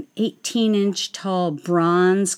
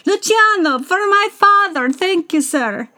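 put-on Italian accent; 10 semitones higher than usual. Fan gushing to [name] in mock Italian accent. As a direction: ham up the Italian like an opera fan. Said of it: "you speak in an Italian accent".

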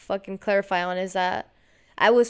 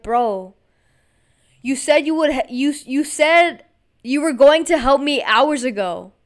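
louder, higher, exasperated rant at a roommate; retold angry outburst; 4 semitones higher, 7 dB louder than usual. To the roommate who offered help too late, a loud irritated retort. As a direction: let the frustration burst out as you replay it.

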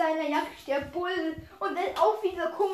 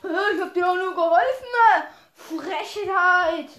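loud, offended retort; indignant protest; 2 semitones higher, 7 dB louder than usual. Acted. Helper defending the good deed, loud indignation. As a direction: protest loudly at being blamed. Acted indignant reply to threat.

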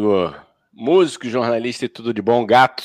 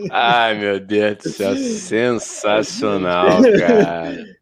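stoned; much slower than usual. Pretend. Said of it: "Que brisa".

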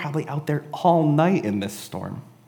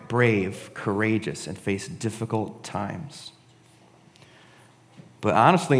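ordinary talk, nothing marked

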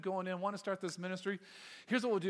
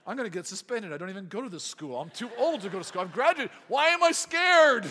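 mock panic; 2 semitones higher, 10 dB louder than usual. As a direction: work yourself up into comic outrage at being scared. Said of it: "puts fear and intimidation into me".

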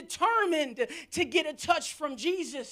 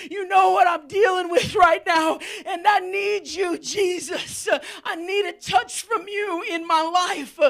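desperate cry to Jesus; 3 semitones higher, 7 dB louder than usual. Shown as a demonstration. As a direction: cry out like a desperate man praying. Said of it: "desperation looks a little bit like this".

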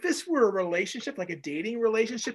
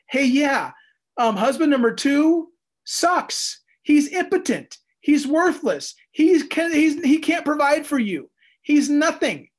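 voicing God; 7 semitones higher, 6 dB louder, slower than usual. God's message voiced, rant against husband.

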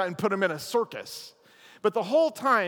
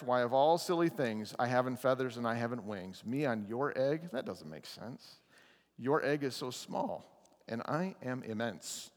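lower, softer elephant voice, amused; big gentle elephant; 6 semitones lower, 6 dB quieter than usual. Acted. Elephant gently refusing the bird, lower and softer voice.

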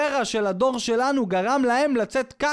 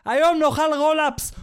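raised, higher mock yell; husband shouting back; 6 semitones higher, 4 dB louder than usual. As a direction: call it out to the wife from the bedroom. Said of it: "הוא צועק לאשתו". (צעקה של בעל מהחדר)

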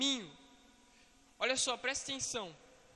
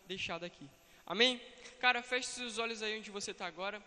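ordinary talk, nothing marked

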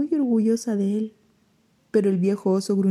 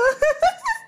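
very high giggle; high squeal laugh. Acted. Pepe laughs.

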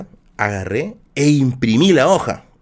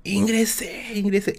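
retold joyful shout; 8 semitones higher, 4 dB quieter, slower than usual. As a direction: imitate calling the good news across the street. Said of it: "Y yo le grito". (grito de alegría imitado, agudo y sin volumen)

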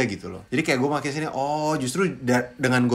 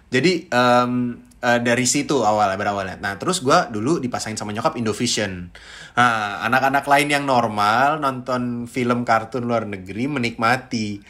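ordinary talk, nothing marked

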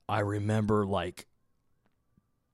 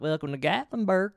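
put-on high-pitched, cutesy Gatlinburg accent; cute local accent; 9 semitones higher than usual. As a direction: imitate an older lady's cute Gatlinburg welcome. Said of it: "that fucking cute-ass accent".

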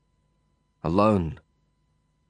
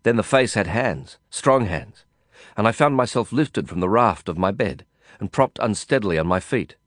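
plain reading voice

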